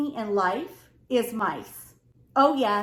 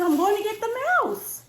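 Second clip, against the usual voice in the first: voicing husband; 9 semitones higher than usual. Husband's reply voiced.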